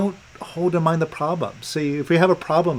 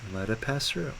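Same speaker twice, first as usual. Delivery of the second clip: very soft, low meditative prompt; hushed guided-release voice; calm guidance; 4 semitones lower, 11 dB quieter than usual. Real, not acted. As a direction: guide them gently, barely disturbing the quiet. Guides client through releasing.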